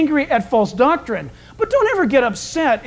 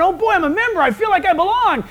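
higher, gushing new member; a light, pumped-up cheer; mock excited; 7 semitones higher than usual. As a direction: bounce with fresh joy. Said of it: "You get all excited".

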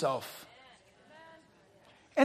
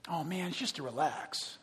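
mock excuse; 6 semitones lower, 11 dB quieter than usual.

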